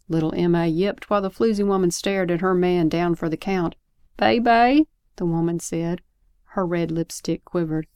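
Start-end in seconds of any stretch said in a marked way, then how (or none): 4.2-4.9 raised, louder pet-name call; drawn-out call to a lover; calling her man. Acted. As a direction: call out to a sweetheart, high and loud. Woman calls to her fallen man.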